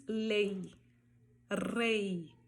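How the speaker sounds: LEI and REI gliding into I, trilled R on rei; final Y as I. Shown as a demonstration. Teacher giving final Y examples, "ei" endings. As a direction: end lei and rei on an I sound. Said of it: "ela vai ter som de I".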